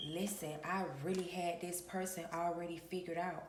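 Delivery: ordinary delivery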